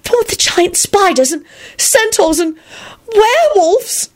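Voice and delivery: high squeal, building to a peak; horrified squeal. A narrator's reading. Elizabeth to Lupin about forest creatures, horrified high squeal. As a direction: squeal the list of monsters in alarm. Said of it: "she squealed".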